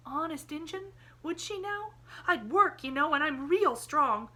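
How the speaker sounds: put-on a boy grabbing at a chance; eager hope. A narrator's reading.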